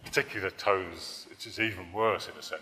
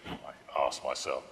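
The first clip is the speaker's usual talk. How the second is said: ordinary delivery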